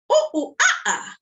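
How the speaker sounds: very high, loud monkey calls; monkey hoots. Shown as a demonstration. Storyteller showing kids the monkey noise, high hoots. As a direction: hoot like a playful monkey. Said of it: "Let's try it".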